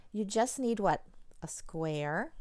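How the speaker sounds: ordinary talk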